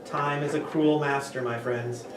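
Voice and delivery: normal talking voice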